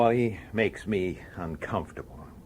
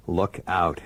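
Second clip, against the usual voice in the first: ordinary delivery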